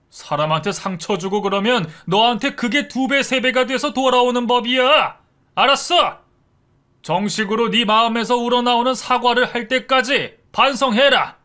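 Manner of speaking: loud, high furious scolding; a father roaring at his lying son. A narrator's reading. Father scolding his son for lying, furious loud shouting. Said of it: "아버지가 아주 많이 화가 나셨답니다".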